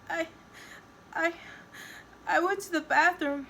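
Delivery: high, halting tearful voice; sniffling. A narrator's reading. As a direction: stammer it out in a high whimper. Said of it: "she sniffs".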